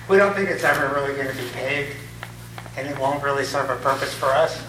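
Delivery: ordinary delivery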